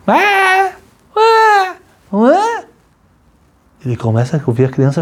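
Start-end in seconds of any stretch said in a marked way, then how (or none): 0.1-2.6 put-on high wailing baby cry, much higher; a shrill-free, high infant wail in three bursts; imitated baby cry. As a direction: mimic a newborn crying out. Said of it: "Uma criança começa a chorar".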